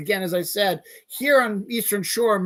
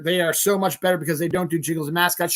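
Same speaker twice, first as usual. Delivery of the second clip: ordinary talk, nothing marked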